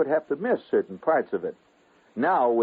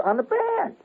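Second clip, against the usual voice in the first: very high, deadpan answer; high matter-of-fact. Retold or imitated. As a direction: state it as obvious. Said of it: "He says".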